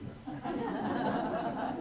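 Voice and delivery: chuckling it out; laughing. Real, not acted. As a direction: let the words dissolve into laughter. Laughing at rough first week.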